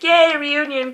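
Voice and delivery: mock cheer. Fan reacting to a co-star's cameo, retold cheer. Retells own delighted reaction to reunion.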